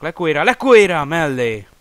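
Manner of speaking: loud rising cry at the miss; excited commentary. Real, not acted. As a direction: shout the near-miss live. Big miss.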